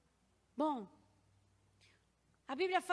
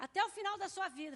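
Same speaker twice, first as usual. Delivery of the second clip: louder and fast, a doctor's stern decree; hammed-up doctor's verdict; mock stern verdict; 6 semitones higher, 5 dB louder, much faster than usual. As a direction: rattle off the sentence like a grim diagnosis. Doctor delivers lifelong verdict.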